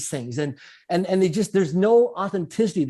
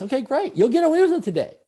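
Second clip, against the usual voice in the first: sarcastic okay great, pitched way up; sarcastic, far higher than usual; mock approval; 9 semitones higher than usual. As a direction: scoff at the cheater's win in a high mocking voice.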